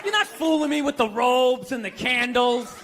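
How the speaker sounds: usual speaking voice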